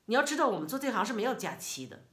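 你要知道我们做这行是没有假期的, fast and loud with 道 stressed; curt scolding tone. Retold or imitated. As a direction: snap it back at her impatiently. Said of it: "就是这样一种语气".

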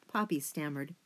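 plain reading voice

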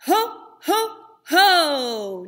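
loud, punchy ho ho ho from the belly; belly-driven loud ho ho ho; diaphragm ho ho ho. Shown as a demonstration. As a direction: pump out three loud ho's from the diaphragm. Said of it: "Try it again".

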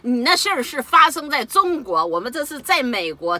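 loud, high mimicking of the interpreter; mock embassy woman. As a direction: mimic her dismissive reply scornfully. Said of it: "这个女的说的". (高声模仿翻译女子的冷漠口气)

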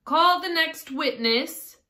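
high, loud, slow summons; king's ringing, drawn-out proclamation; calling out. A narrator's reading. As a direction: call it across the courtroom. King calls out in court.

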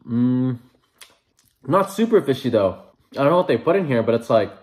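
chewing between words; talking while chewing. Real, not acted. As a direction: mouth partly full. Seen 1: Bites egg roll near 10s; closed-lip chewing through 12.7s.

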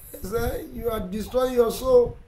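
ordinary talk, nothing marked

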